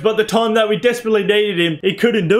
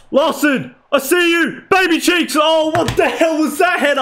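much higher, playful pet name; high excited calls; 8 semitones higher than usual. Real, not acted. Gamer teasing his winger mid-attack, high excited voice. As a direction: cheer him on, then groan. Cheering then disbelief.

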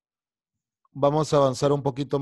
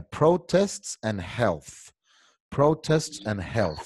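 slow model reading; much slower than usual. Shown as a demonstration. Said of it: "Quiero que todo el mundo me diga".

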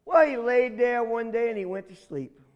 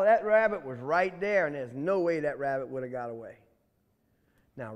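ordinary delivery

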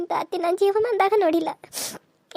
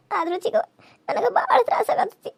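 high, tearful wail; crying; 4 semitones higher than usual. Acted. Young wife confessing her suffering to her aunt, crying. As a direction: let the tears break the line.

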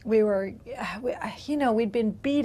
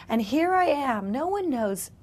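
normal talking voice

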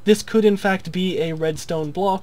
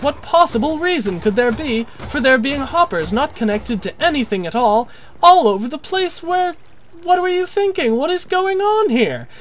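climbs much higher and louder into hammed-up exasperation; building mock outrage; 8 semitones higher, 4 dB louder than usual. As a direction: rant as an appalled viewer, slowing on madness. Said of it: "you might ask".

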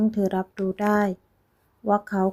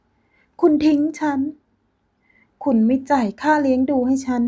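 much higher, louder accusation; furious shout; 6 semitones higher, 4 dB louder, slower than usual. Acted. Ex-girlfriend shouting at Frank about abandonment. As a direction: hurl the charges at him.

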